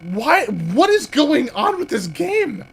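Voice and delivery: high, slow, baffled; a drawn-out confused cry; bewildered. Real, not acted. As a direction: stretch it out in disbelief. Game glitches unexpectedly.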